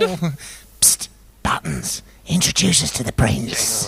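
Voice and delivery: hissed psst aside; whisper. Acted. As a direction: whisper to catch his attention. Calling Buttons on the sly.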